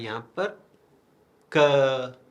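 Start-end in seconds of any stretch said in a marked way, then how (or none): 1.6-2.2 "क" with the vowel drawn out; vowel held. Shown as a demonstration. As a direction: stretch the vowel of "क" to show its sound. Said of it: "अ का sound यहाँ पर rise कर रहा है".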